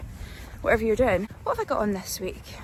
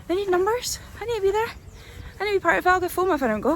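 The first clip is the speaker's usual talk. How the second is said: excited self-talk; 9 semitones higher, faster than usual. Retold or imitated. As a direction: talk yourself into going, pitch climbing. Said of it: "I was like".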